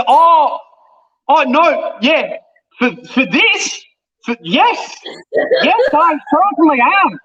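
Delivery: stammer, then bluff with mock confidence; flustered bluff. Young actor caught unprepared at an audition, flustered bluff.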